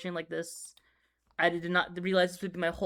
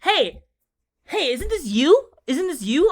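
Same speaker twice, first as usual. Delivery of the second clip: excited acquaintances; 9 semitones higher, 9 dB louder, much slower than usual. Retold or imitated. Acted DMs from people who know him.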